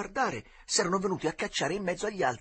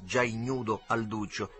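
ordinary delivery, nothing marked

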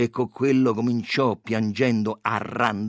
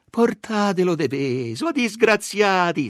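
high, raised reproachful cry; pitying shrieks; 7 semitones higher, 5 dB louder than usual. A narrator's reading. Kinder women scolding the policemen, high loud crying out. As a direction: cry out on the boy's behalf. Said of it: "strillavano altre più pietose".

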